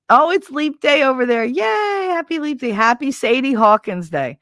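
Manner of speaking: excited. Real, not acted. Greeting viewers.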